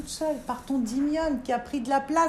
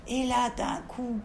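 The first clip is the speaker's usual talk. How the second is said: ordinary delivery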